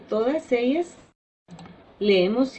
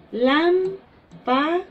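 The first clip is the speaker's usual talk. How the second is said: lámpara very slowly, LAM... pa... ra, raised pitch; syllable by syllable; 6 semitones higher, much slower than usual. Shown as a demonstration. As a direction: break lámpara into three beats with long pauses. Teacher splits example word into syllables. (lámpara sílaba por sílaba)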